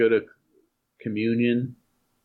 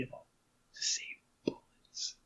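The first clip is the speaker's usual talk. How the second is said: whispered; 15 dB quieter than usual. Retold or imitated. Classmate muttering the joke from behind the desk. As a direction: drop to a whisper for the punchline.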